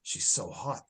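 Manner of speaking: drooling. Retold or imitated. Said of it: "He is just drooling over her".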